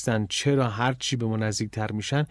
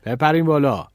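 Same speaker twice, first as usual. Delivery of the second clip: louder, brisk call; raised voice; 3 semitones higher, 6 dB louder than usual. A narrator's reading. Cart driver to waiting friends, calling out. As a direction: call out an order to climb aboard. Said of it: "صداش بلند شد".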